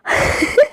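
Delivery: short airless laugh; breathy burst of laughter. Real, not acted. Brother laughing at the sister's kohl, airless laugh. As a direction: laugh out at the teasing. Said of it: "کہہ کہہ لگاتا".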